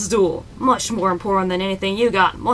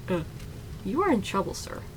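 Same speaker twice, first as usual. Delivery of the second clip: ordinary talk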